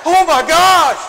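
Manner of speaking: loud amazed exclamation; amazed new parent. Retold or imitated. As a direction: gasp it out like a parent holding a newborn. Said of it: "you're like".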